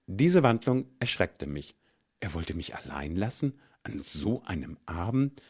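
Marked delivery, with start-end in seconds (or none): none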